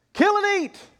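very high, loud order; commanding shout. Acted. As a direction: shout it like a voice from heaven. God's command repeated.

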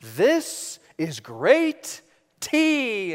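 very slow, savoring mock-royal; hammed-up kingly relish, drawn out; playing the king. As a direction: stretch each word like a pompous king tasting. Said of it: "I said".

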